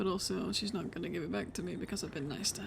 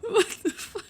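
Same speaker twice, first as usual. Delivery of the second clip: much higher, louder burst of disbelief; surprised outburst; 12 semitones higher, 8 dB louder, slower than usual. Real, not acted. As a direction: blurt it out in shock. Bursts out at friend's story.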